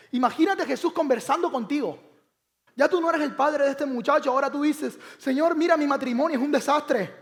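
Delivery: loud, high preaching build; fervent preaching. Acted. Preacher calling out to the congregation, loud and fervent. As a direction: raise the voice and plead with the congregation.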